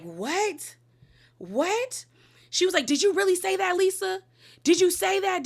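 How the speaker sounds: high, incredulous mock gasp of What; shocked disbelief. Recapper voicing Bronwyn stunned at Lisa's claim, high incredulous.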